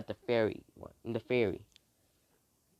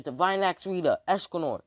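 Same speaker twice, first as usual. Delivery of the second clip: louder, higher attack-name call; acted call-out; 7 semitones higher, 4 dB louder than usual. Calling out weapon names while handing them out.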